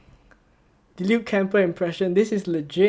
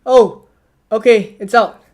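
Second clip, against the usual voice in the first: a loud burst of relief as the news drops; sudden excitement; 8 dB louder than usual. Real, not acted. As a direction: blurt it out, suddenly louder. Leak finally appears on stream.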